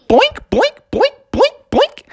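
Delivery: very high, bouncy boing noises; cartoon boink. Froggy's tongue grabbing papers, very high cartoon boinks. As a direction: pop each boink like a springy tongue. Frog tongue sound effect.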